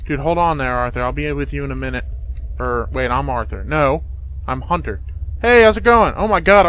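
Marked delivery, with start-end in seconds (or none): 5.4-6.7 excited greeting. Acted. Hunter voiced greeting Arthur.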